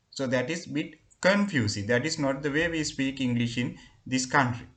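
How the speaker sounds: ordinary talk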